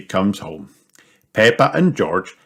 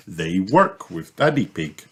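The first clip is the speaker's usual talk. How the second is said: usual speaking voice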